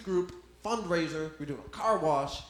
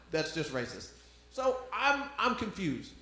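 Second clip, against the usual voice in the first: usual speaking voice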